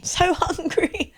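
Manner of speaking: put-on slow, high childish voice; cutesy voice. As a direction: stretch it out like a small child. Echoes the cute voice, laughing.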